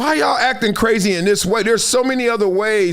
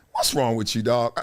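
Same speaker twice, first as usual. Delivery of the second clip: low, quiet mock concern; softly pitched-down judgmental question; judging aside; 9 semitones lower, 5 dB quieter than usual. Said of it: "I'm like".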